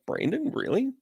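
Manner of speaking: high, disbelieving squeak; mock disbelief at the news; incredulous. Viewers mocking him in disbelief. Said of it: "And you're like".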